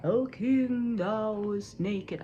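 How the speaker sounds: drawn-out, calling mystery voice; a loud opening call that stretches out slowly; different voice. Acted. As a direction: intone it like a disembodied voice on the wind. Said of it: "a different voice".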